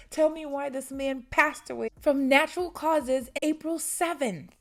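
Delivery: heated rant; indignant. Real, not acted. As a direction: protest it in real disbelief. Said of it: "I'm so mad".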